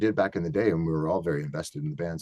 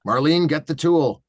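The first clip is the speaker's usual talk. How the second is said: slow, loud, higher-pitched call; yelling dad; 7 semitones higher, 6 dB louder, slower than usual. Retold or imitated. Dad yelling to Marlene when something goes wrong, hollering. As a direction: holler like dad summoning help. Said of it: "my dad yells".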